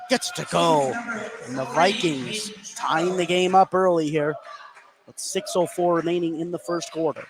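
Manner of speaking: ordinary talk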